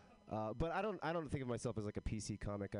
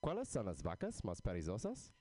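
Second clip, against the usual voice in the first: joke "Texas accent". Shown as a demonstration. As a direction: joke Texas accent that is really fluent Spanish. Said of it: "in an authentic Texas accent".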